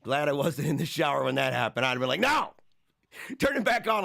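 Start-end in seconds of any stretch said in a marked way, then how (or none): 2.2-4.0 mock panicked cry. Imagined shower water cut off.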